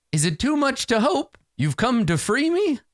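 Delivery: sad unicorn. Acted. Trapped unicorn hoping to be freed, high sad plea. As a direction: plead in a high, theatrical voice. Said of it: "nods sadly and, uh, and says".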